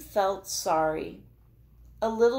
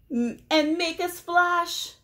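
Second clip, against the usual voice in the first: high, loud hippo voice; excited hippo; splashy hippo; 12 semitones higher, 6 dB louder than usual. Acted. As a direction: make a big splash with it. Hippo voice in picture book.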